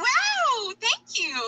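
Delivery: hammed-up sarcastic thank you. Gymnast mocking thanks to hateful tweets, sarcastic. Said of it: "am I supposed to be like".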